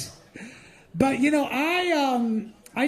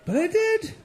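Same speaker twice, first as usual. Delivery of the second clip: high-pitched; 11 semitones higher than usual. Real, not acted. Repeated punchline, high.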